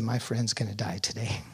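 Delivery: hushed whispered despair. Retold or imitated. Nurse whispering to him at the bedside. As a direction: breathe it out as a whisper.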